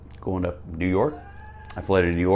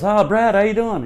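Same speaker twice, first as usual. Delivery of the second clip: high, loud imitation of a greeting; pitched-up, hearty mock hello; Larry; 12 semitones higher, 5 dB louder than usual. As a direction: imitate a road manager hailing a newcomer. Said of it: "and Larry goes".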